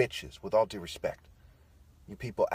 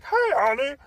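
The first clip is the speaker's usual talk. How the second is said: much higher and louder goofy hello to the wife; dumb husband voice; 13 dB louder than usual. Retold or imitated. Husband home to his wife, a much higher, louder dopey greeting. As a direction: ham up a clueless husband coming home. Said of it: "like a stupid Barney fucking Simpson type".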